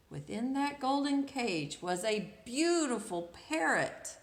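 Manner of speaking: lively read-aloud storytelling; story narration. A narrator's reading. Storyteller reading to children, lively narration. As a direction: read it like a picture book to kids.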